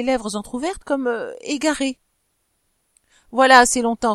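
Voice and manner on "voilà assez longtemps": loud angry burst on voilà; swearing outburst. Acted. Husband swearing at his wife, loud angry burst. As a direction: explode at her, cursing. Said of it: "reprit-il en jurant".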